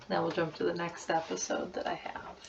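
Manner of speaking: whispered aside; soft whispered aside. Real, not acted. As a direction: whisper it under your breath. Unvoiced aside.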